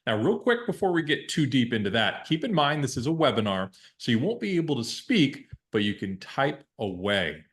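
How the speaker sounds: ordinary delivery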